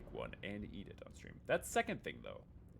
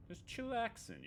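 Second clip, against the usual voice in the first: playful high voice; 13 semitones higher than usual. Pretend. Cooling off, playful.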